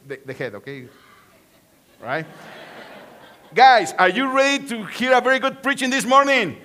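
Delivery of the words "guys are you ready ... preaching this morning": rally the room, louder; louder call to the crowd. Shown as a demonstration. Pastor repeats the question louder for a shouted reply. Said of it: "Okay, again, again".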